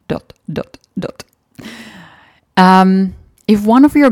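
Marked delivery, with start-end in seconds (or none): 0.1-1.2 soft whisper, trailing off; whispered dots. Acted. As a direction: whisper the dots as a teasing pause. Finish this sentence, trailing dots.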